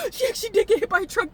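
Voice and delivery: high, fast, strained words; laughing through tears. Real, not acted. Vlogger recalling Gangle, high laughing-crying voice. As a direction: push it out while half-crying.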